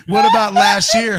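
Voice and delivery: high, loud, swaggering sports-fan voice. Retold or imitated. Imitating his friend chatting up the actress, high and cocky. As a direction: play the cocky charmer showing off. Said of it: "he's like fucking [name]".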